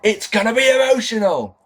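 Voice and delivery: high-pitched mock proclamation; hammed-up catchphrase, pitched high; Vinnie Jones impression. Mocking Jones's line out of context, high hammed-up delivery. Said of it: "doesn't work in the context".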